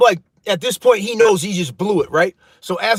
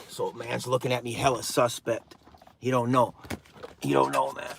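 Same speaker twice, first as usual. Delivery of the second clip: drops to a low, soft voice; hushed aside; 10 semitones lower, 12 dB quieter than usual. Real, not acted. Vlogger confides to viewers the robber doesn't know, hushed. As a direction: confide it under the breath.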